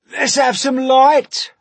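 loud, high, drawn-out centipede shout; big high yell for light; shouted demand. A narrator's reading. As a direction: holler it out to the whole room, slowly. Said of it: "shouted the centipede".

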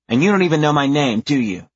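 put-on fast, high, loud kid challenge; sassy little girl. A narrator's reading. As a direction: call him out like a bratty kid.

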